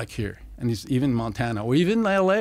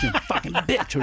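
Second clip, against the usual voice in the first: mom's furious yell; 9 semitones higher than usual. Retold or imitated. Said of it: "yells something about".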